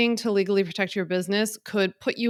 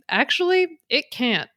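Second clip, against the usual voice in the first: higher-pitched, frank correction; Philly-girl bluntness, pitched up; blunt straight talk; 6 semitones higher than usual. Acted. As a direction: give it to them straight. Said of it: "wants to give it to you straight".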